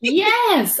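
high, loud cheer; delighted exclaim; excited yes. Retold or imitated. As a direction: cheer like your song just came on. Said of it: "And I was like".

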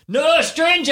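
high-pitched mock shout; imitated child's shout. Daughter answering the pretend stranger, imitated high shout. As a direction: mimic a small girl yelling a warning. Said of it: "And then started jumping and shouting".

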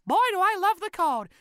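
very high, loud cartoon squeak; high-pitched cartoon enthusiasm; cartoon character. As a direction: gush it in a falsetto cartoon voice. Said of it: "this line where it's like".

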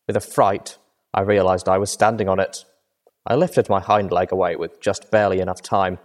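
plain reading voice

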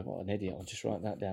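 a note to self, under breath; quiet aside. Real, not acted.

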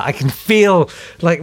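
usual speaking voice

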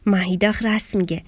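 usual speaking voice

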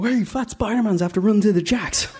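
put-on high-pitched kid calling out; jeering kids. Kids spotting Spider-Man fleeing to the toilet, high jeering call. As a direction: raise the alarm like a taunting child.

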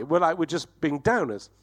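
ordinary talk, nothing marked

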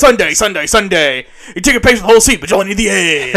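loud, hammed-up arena advert; monster truck voice. Shown as a demonstration. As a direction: sell the rally at a roar. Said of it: "the monster truck voice".

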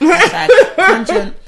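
loud, slow, laughing hashtag joke. Real, not acted. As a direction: announce it through laughter. Joking self-comment.

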